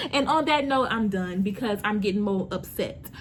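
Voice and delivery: usual speaking voice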